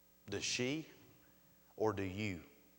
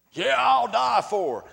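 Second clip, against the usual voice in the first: high, loud burst of bravado; big booming mock-heroic vow; gung-ho husband shout; 11 semitones higher, 13 dB louder than usual. Husband eagerly vowing heroic devotion.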